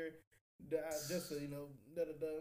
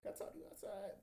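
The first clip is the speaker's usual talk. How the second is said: whisper; 4 semitones higher, 8 dB quieter than usual. Retold or imitated. Pulling the girl aside quietly.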